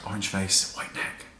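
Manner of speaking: sarcastic near-silent whisper; whispering a catty aside; whispered jab. Acted. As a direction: whisper the insult as a parting shot. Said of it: "sarcastic whispered sign-off".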